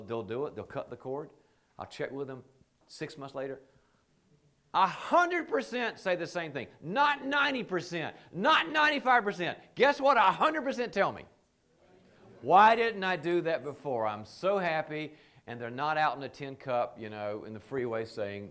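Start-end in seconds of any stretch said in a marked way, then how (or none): 4.7-11.2 louder and higher, hammering each number; emphatic shout. Real, not acted. Building emphatic point to audience. 12.4-15.1 loud, slow, higher and delighted; exclaiming happily, slow and loud; relieved joy. Acted. Acted relieved client, loud.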